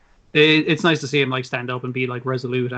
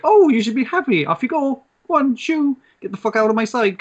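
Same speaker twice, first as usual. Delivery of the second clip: high, mocking mum brushing her kid off; high sneering mother shooing her son; dismissive mother; 9 semitones higher than usual. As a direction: shoo him away in a high put-on voice. Dismissive mother voiced in story.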